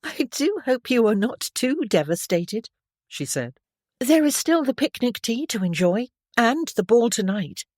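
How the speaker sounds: a hint of a shy girl making conversation; polite young lady. A narrator's reading. Stephanie congratulating Bertrand on the archery, lightly girlish.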